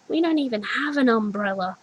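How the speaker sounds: ordinary delivery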